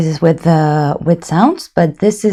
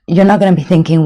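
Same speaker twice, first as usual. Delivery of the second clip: normal talking voice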